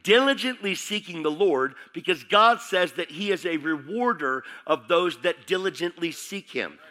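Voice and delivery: loud, high preaching climax; full-force preaching. Acted. Pastor exhorting the congregation, fiery preaching. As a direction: preach it hard to the church.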